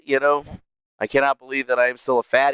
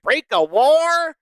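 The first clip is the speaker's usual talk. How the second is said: mock quote; 11 semitones higher, 4 dB louder, much slower than usual. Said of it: "are like".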